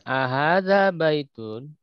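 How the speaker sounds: "Ahadza baitun?" read slowly with a rising question tune. Shown as a demonstration. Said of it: "nadanya nada pertanyaan ya".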